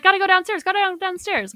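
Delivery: high, loud frantic chant; hammed-up scramble to flee; mock panic. Voicing everyone fleeing in the film.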